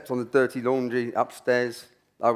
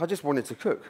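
ordinary talk

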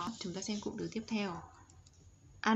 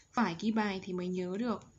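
normal talking voice

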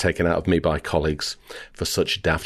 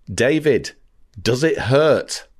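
higher, imitated reporter's shout, drawn out at the end; desperate retold shout; 7 semitones higher, 3 dB louder, slower than usual. Reporter to injured David Beckham at arrivals, mock desperate shout. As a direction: re-enact calling out a desperate question. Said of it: "in desperation I shouted".

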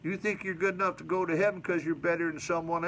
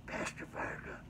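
hushed whisper of a name; 13 dB quieter than usual. Retold or imitated. Retelling the visitor who whispered in the pastor's ear, hushed whisper. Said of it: "he whispered in my ear".

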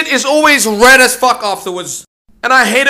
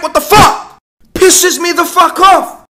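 fed-up shout at the ads; angry rant; 5 semitones higher, slower than usual. Acted. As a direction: spit out the frustration. Ranting about pop-up ads.

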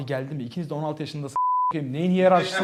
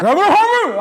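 incredulous; 13 semitones higher, 6 dB louder than usual. Real, not acted. Incredulous exclamation.

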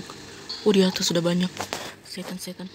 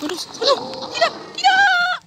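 terrified scream; 4 dB louder than usual. Real, not acted. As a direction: shriek in terror. Scared by game monster.

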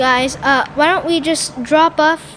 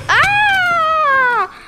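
sudden high yelp; a quick high cry of fright; startled yelp; 12 semitones higher than usual. Real, not acted. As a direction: jump at the scare. Sudden scare in game.

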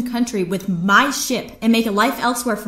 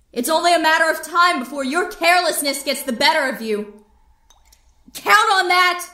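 desperate shouting; 9 semitones higher than usual. Acted. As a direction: shout after him so he can't ignore it. Niece shouting after departing uncle.

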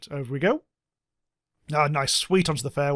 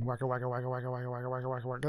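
soft chopping wagga wagga rotor noise; low-key helicopter sound effect; helicopter impression; 4 semitones lower, 12 dB quieter, much faster than usual. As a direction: mimic helicopter blades, quietly. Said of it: "That's my helicopter impression".

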